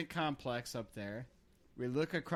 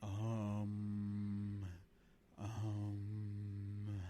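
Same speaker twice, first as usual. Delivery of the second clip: low, soft meditation voice with long hummed ums; mock meditation sponsor; 6 semitones lower, 7 dB quieter, slower than usual. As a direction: parody a calm sponsor read, then hum. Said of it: "This meditation moment was brought to you by".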